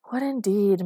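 ordinary delivery, nothing marked

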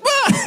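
high, loud squeaky yelp of a flung mouse. Retold or imitated. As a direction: squeak like a tiny mouse flying. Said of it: "flick him, and he goes".

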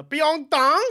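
high, loud, drawn-out mock Korean accent; exaggerated Korean-accented dish name; put-on Korean accent. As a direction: order in a hammed-up Korean accent. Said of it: "I switch to the accent".

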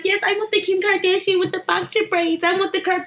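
put-on high, bubbly valley girl.

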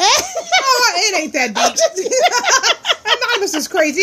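laughing it off; giggling through the protest. Real, not acted. Laughing at the request.

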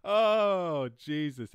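loud, high horrified gasp; shocked. Real, not acted. Streamer horrified at his own slur, loud and shocked. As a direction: recoil at what you just said.

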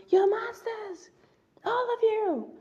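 high, strained cry of accusation; quoting the character's outburst. Retold or imitated. Vlogger quoting Sejanus's outburst, high strained cry. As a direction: yell it high at the Capitol crowd. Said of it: "he throws the chair and he goes".

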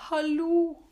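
loud, high call of Hallo! Stopp!; shouting. Retold or imitated. Her body yelling at her to stop, loud high shout. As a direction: yell it like an alarm going off. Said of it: "kroppen min bare roper".

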